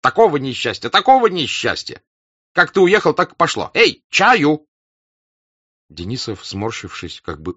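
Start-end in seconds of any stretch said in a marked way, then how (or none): 0.0-4.7 loud, high furious ranting; angry rant. A narrator's reading. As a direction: rant at the rotten luck. Denisov rants about losses.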